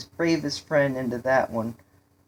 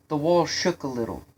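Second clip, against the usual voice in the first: usual speaking voice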